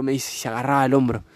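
ordinary talk

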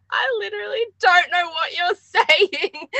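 high, slow, helpless laughing; retold confusion, cracking up and drawn out; laughing bafflement. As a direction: squeal it out through laughter. Recalls failing to understand aunt's accent.

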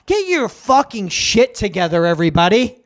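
ordinary delivery

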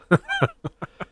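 squealing laugh; high burst of laughter. Real, not acted. Laughs at own joke.

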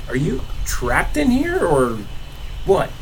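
high, slow, startled question; high surprised character voice. Acted. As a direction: ask it high and amazed. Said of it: "He's like".

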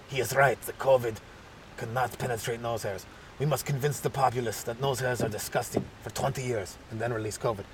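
low, soft, deliberate villain voice; hushed mock mastermind; sinister scientist. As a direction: plot quietly, low and measured. Second scientist in acted plot.

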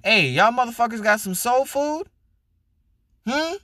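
mock pushy customer. Imitating customer in wrong restaurant.

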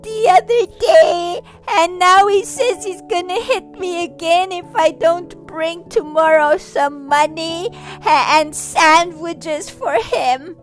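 put-on a little boy crying to the ghost about the bully; crying child. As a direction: sob it out like a bullied kid.

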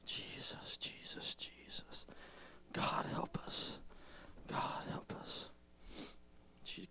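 barely audible whisper; whispered prayer. Real, not acted. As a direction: pray under your breath. Altar call whispered prayer.

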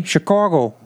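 mock Chicago city accent; exaggerated Chicago-accent place name. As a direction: say it like a Chicago local. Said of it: "people in the city".

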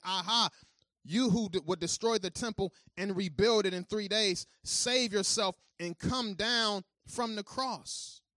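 loud, slow jeering at the crucified man; mocking crowd. Passersby jeering at Jesus on the cross, loud mocking taunt. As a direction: taunt him from the roadside, drawn out.

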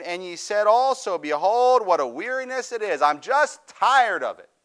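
high, driving pulpit delivery; impassioned preaching. Real, not acted. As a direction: preach it with force, pitched well up and stretching the key phrases. Preaches at full force.

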